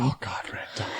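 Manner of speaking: whispered groan of disgust. Real, not acted. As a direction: breathe it out in dismay. Groaning about terrible movie.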